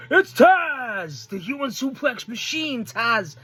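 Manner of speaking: high, drawn-out hype shout; excited announcement. Real, not acted. Fan introduces a beloved wrestler to viewers, excited announcement. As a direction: announce the star like a big reveal.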